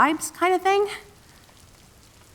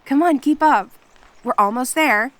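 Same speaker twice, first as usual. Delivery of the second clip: higher and louder, cheerful call; calling ahead; 4 semitones higher, 6 dB louder than usual. Acted. Kitsune calling back to friend lagging behind, bright call. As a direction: call back over your shoulder.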